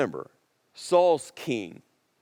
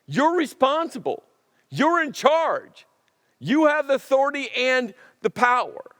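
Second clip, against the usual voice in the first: urging confrontation; 7 semitones higher than usual. Acted. Preacher confronting Saul, building.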